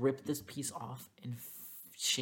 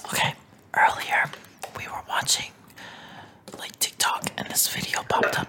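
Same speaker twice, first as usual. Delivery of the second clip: close-mic ASMR storytelling; whispering; 10 dB louder than usual. Pretend. Host retelling a TikTok for ASMR, whispered. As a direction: whisper the story in short phrases.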